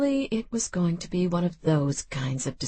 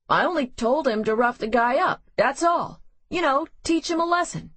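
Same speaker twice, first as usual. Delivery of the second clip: higher and faster, talking himself up; defensive and rising; 6 semitones higher, faster than usual. Acted. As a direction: brush it off quickly, pitch climbing.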